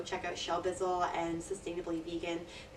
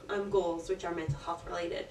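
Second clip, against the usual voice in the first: ordinary talk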